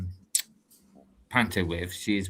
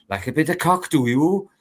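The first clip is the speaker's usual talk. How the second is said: put-on Welsh accent, pitched up; 5 semitones higher, 5 dB louder than usual. Recalling a saying for the accent, put-on Welsh. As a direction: try a cheeky Welsh question. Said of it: "try to do the accent".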